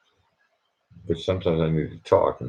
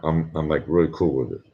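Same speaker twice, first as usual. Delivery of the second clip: normal talking voice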